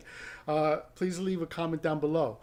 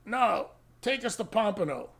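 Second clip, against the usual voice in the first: higher mock drunk insistence; higher, slurry-sounding stubborn demand; stubborn drunk lady; 6 semitones higher than usual. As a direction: play the tipsy passenger digging in. Drunk passenger refuses to leave.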